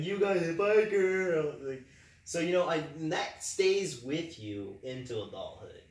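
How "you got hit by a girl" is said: playground mockery, higher, louder and slow; mock-taunting a boy at recess, louder; kids' teasing taunt. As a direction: taunt him like a schoolkid at recess. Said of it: "We teased the crap out of him".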